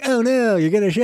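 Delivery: squeaky fake alarm; high mock panic. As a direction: pitch it up in mock fear. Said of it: "they're like".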